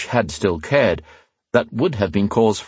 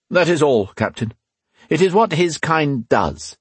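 dismissive inquisitor; 8 semitones higher than usual. A narrator's reading. Leader dismisses the captain.

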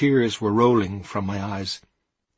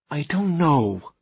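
hesitant; 6 semitones higher, 4 dB louder, much slower than usual. A narrator's reading. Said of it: "I said after a lengthy pause".

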